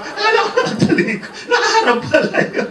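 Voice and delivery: high, loud laughing voice; laughing hard. Real, not acted. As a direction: crack up while repeating the punchline. Cracks up repeating own joke. (tumatawa nang malakas habang nagsasalita)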